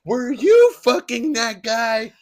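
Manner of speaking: slow, high, drawn-out accusation; mock dramatic accusation. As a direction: stretch the accusation for comic effect. Quoting the girlfriend.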